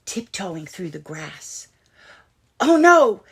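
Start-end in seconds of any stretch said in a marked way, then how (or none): 2.6-3.2 loud high gasp of alarm. A narrator's reading. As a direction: cry out in alarm. Narrator alarm.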